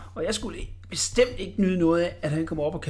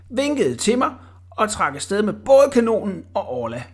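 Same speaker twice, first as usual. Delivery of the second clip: plain reading voice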